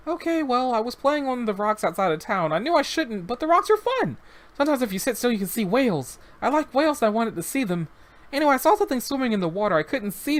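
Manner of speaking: put-on high, eager little boy's voice; young boy. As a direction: voice the rescued child, innocent and chatty. Child voice reading interview.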